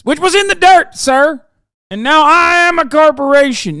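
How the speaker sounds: high, loud mocking senator voice, groveling sir; mocking Mark Wayne Mullin. Streamer mocking senator addressing the chairman, high and loud. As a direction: ham up a whiny, indignant senator pleading he came from the dirt. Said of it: "I can't even do his fucking accent".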